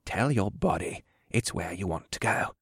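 put-on hushed old mentor voice; very soft old man murmur; soft muttering. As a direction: coach him quietly, under your breath. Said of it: "Harold muttered softly".

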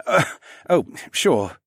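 small forced laugh into agreement; flustered, half-laughing assent; harried chuckle. A narrator's reading. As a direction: chuckle uneasily, then give in. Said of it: "with a harried chuckle".

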